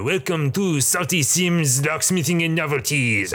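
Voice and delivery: bored goblin clerk in a fake accent; put-on shopkeeper voice. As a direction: sing out the shop welcome like a hammy clerk.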